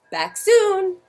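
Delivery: high, slow little voice; hopeful owlet. A narrator's reading. Owlet Percy answering Sarah, high and slow. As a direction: reassure the others hopefully. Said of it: "said Percy".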